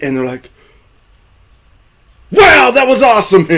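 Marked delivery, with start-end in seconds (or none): 2.3-3.5 high, loud mock-thrilled shout; excited. Retelling the crew after tornadoes spun the car, excited. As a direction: whoop it like an exhilarated storm chaser.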